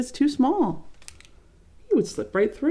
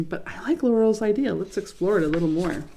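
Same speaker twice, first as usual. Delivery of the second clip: ordinary delivery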